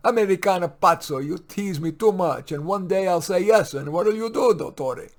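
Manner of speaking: teasing Italian maid. Acted. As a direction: tease him back, half laughing. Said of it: "giggling madly".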